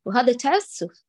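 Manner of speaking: ordinary delivery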